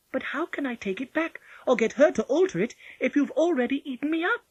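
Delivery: put-on high, light child's voice; Polly, a reasoning little girl. A narrator's reading.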